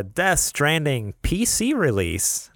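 slow, drawn-out start; slow, halting read. Real, not acted. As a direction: read it slowly, distracted. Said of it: "You keep throwing me off here".

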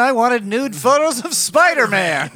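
loud editor, slowing on Spider-Man; barking newspaper editor. Retold or imitated. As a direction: bellow like a furious newspaper boss. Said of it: "It was J K Simmons".

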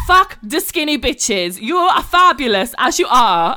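put-on high, slow, loud Georgian-accented pep talk. Seamstress Nini rallies the bride, loud Georgian accent. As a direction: belt it like a fierce Georgian seamstress. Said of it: "I'm gonna try and do her accent".

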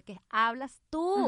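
loud slow what-are-you-talking-about; incredulous retort. Retold or imitated. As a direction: throw it back in disbelief. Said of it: "yo decía".

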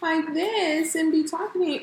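high, playful put-on voice; mocking silly voice. Shown as a demonstration. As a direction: say it in a goofy unserious tone. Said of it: "I'm not gonna sit there and say it like this".

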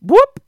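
very high, loud whooping blip; Skype noise imitation. Shown as a demonstration. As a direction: shout the message alert sound. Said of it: "sounded like the Skype messaging noise".

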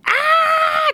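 sudden high shrieking yell; imitated scream. Toddler screaming bloody murder into a pillow, imitated high scream. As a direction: let out a short piercing scream like a toddler into a pillow. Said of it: "Just, like, screaming".

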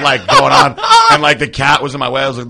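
ordinary talk

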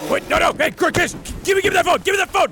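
high, loud frantic yelling; frantic shout. Real, not acted. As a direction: grab the phone in a panic. Grabbing the phone.

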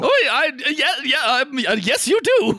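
high, loud, stammering panic; flustered shopkeeper. Acted. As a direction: babble nervously in a high voice. Shopkeeper stammers to intimidating customer.

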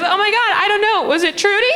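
very high, panicky mom imitation; high mock-flustered mom voice. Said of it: "And she goes".

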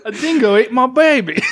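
high, slow hammed-up Australian accent; mock Australian accent. Host joining the accent bit, mock Australian accent. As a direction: wail it in a caricature Australian accent.

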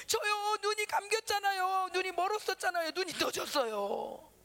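high, stretched-out cry of wonder; imitated elderly woman's high, wailing testimony; amazed testimony. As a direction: call out the miracle in long drawn words. Old woman testifying from the back.

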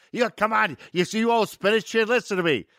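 high-pitched imitation of a goading director; impatient director. Director impatiently feeds actor his line.

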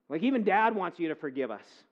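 ordinary delivery

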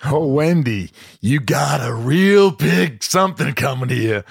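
drawling, taunting Nicholson; Jack Nicholson. Acted. Host as The Shining husband taunting wife, Nicholson drawl. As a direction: taunt her in the movie star's drawl. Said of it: "he goes".

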